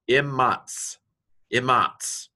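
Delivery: "Imatz" with the doubled M held; teaching demo of "Imatz", M drawn out. Shown as a demonstration. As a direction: linger on the M in "Imatz", then close on tz. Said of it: "hold the M a little bit longer".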